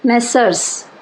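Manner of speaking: मेसर्स with e and final s; messrs plural. Shown as a demonstration. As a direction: say मेसर्स as messers. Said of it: "इसी तरह से मिस्टर". (मेसर्स को 'मेसर्स' बोलें)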